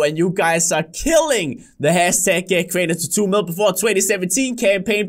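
ordinary delivery

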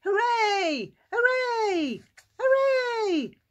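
high, loud hoorays climbing each time; jubilant cheering. Acted. Healed man and Jesus celebrating, cheering. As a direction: cheer as if jumping up and down. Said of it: "jumped up and down and cheered".

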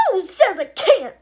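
high, loud challenge; defiant shout. Acted. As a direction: yell at Wonka. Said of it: "shouted Veruca".

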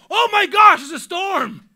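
high, very loud frightened shriek; hammed-up panicked shout; mock panic. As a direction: blurt out exaggerated alarm. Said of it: "and start panicking".

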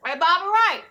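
high, loud holler across a distance; calling out. Acted. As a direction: yell a reminder to a coworker. Calling instructions to Bobby Ray.

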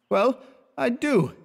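put-on a drunk man owning it; drunk admission. A narrator's reading. Drunk Clifford answers his friend, high slow tipsy.

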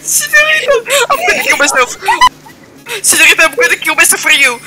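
high, loud crying lament; mock grief wail. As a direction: wail it high in hammed-up despair. Mourning character in game.

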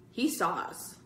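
ordinary delivery, nothing marked

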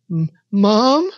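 shaky, tearful call. A narrator's reading. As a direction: call out as a child fighting back tears. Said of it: "he called out shakily, fighting back tears".

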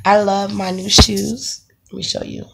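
ordinary talk

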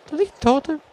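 high loud excited call. Real, not acted. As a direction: shout out the find with glee. Sudden excited find.

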